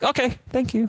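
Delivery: high mock-polite thanks; polite thank you. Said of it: "So I'm like".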